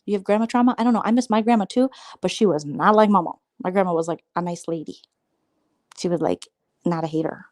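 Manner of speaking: ordinary talk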